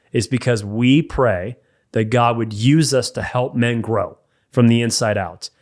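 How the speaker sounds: ordinary talk, nothing marked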